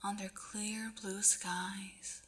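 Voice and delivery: hushed, slow storybook whisper; whispered narration. A narrator's reading. Reader whispering a dream sequence to the listener, hushed. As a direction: breathe the dreamy scene out softly.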